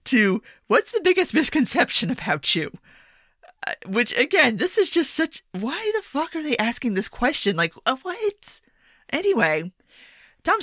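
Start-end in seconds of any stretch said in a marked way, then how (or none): 0.7-2.7 higher, mocking read of the question; a lightly mocking quiz-host voice. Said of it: "here are the replies to". 5.5-8.6 baffled disbelief. Real, not acted. Baffled by debate question.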